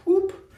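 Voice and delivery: high excited whoop. Pretend. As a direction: yelp it up high. Playful sound effect.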